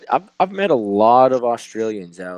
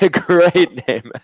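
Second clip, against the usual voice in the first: higher, laughing approval; amused, lifted voice breaking into laughter; 7 semitones higher, 3 dB louder than usual. Real, not acted. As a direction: crack up as you say it. Amused reaction to bar's name.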